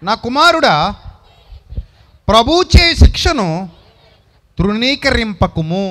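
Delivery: fatherly verse read out at full force; solemn scripture reading. Acted. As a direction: proclaim the verse slowly to the congregation. Reads Hebrews 12:5 aloud.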